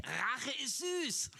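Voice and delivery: slow, relishing revenge; slow gloating; spiteful. Pretend. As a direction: savour the words like the vengeful world. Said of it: "Was sagen wir in der Welt".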